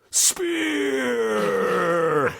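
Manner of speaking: high ringing battle cry; booming cry. Acted. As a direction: call it out to echo through the forest. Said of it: "echoed Swordborn's voice through the forest".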